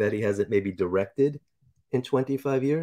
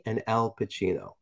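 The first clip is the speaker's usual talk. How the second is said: usual speaking voice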